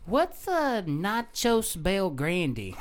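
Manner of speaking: very slow, high, stretched-out question; clueless customer. Retold or imitated. Woman at the counter squinting at the menu, slow high bafflement. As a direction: puzzle out every word at a crawl.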